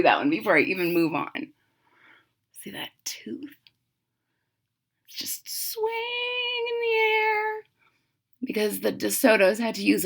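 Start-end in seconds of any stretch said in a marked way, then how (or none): 2.6-3.6 hushed whisper; whispering to the class. Real, not acted. 5.1-7.6 high, slow, soft wonder; showing the picture in awe; high marvelling. Real, not acted.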